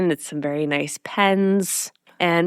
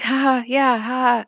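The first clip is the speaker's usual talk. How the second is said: imitated awkward laugh, pitched up; nervous laugh; 6 semitones higher than usual. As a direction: laugh along nervously, mimicking someone uneasy. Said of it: "I'm just nervous".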